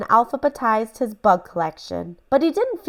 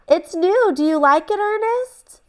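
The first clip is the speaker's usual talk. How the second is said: high, fluttery lady showing off her hat; fussy lady voice; 11 semitones higher than usual. Acted. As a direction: play the vain neighbour lady, pitched up. Missus Peacock voiced.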